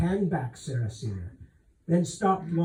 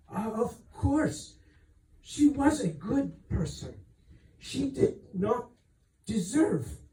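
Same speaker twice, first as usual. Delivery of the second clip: higher, faltering voice on the edge of crying; she's holding back tears for her friend; near tears; 6 semitones higher than usual. Acted. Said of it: "And then the tears came again".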